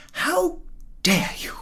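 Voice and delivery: high, hushed outrage; indignant. A narrator's reading. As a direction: draw yourself up, offended. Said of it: "drawing herself up to her full height".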